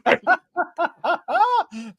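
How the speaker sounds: high, sudden laugh; a real laugh breaking out; burst of laughter. Real, not acted. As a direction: laugh out at the penalties. Laughing at England's awful penalties.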